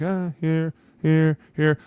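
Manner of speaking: lightly voiced unbothered quarterback; casually pointing out the throw; calm. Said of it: "it's so calmly like".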